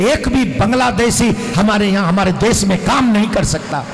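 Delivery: loud, fiery speech rising to a shout; impassioned shouting. Real, not acted. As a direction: rouse the crowd with fury. Fiery call against Bangladeshis.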